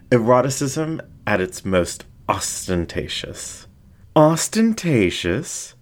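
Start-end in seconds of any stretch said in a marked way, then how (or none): 4.2-5.7 high, slow, rising disbelief; mock-offended fox. Flamboyant fox echoing the narrator, high and slow. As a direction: fling the word back, scandalised.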